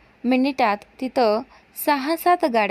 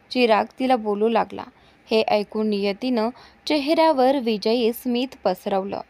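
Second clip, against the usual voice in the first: usual speaking voice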